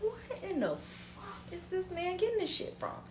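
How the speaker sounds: high, hushed, baffled muttering; baffled disbelief. Retold or imitated. About Miles accusing her of crack, hushed high baffled disbelief. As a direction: wonder aloud in soft, high bewilderment. Boyfriend insisting she does crack.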